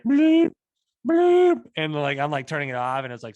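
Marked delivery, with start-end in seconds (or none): 0.0-1.6 loud, high-pitched electronic bleep imitation; phone alarm bleeps. Son imitates dad's phone sending an SOS, high loud bleeps. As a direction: blurt out piercing phone bleeps. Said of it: "phone starts going like".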